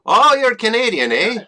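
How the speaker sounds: put-on a clumsy, high, loud Canadian accent on eh; hammed-up Canadian accent ending in a rising eh; bad Canadian accent. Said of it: "not sound anything like a Canadian accent".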